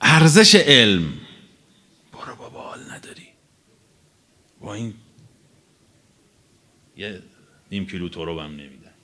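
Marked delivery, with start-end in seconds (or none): 2.1-8.9 whispered brush-off; whispered, waving someone off; dismissive whisper. Retold or imitated. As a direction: toss it off in a whisper. Mimics a dismissive bystander.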